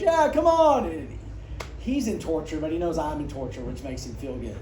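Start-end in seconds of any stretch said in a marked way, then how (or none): 0.0-0.9 high, loud teasing "come on"; gleeful roommate. Retold or imitated. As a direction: invite her over with mock glee, pitched way up.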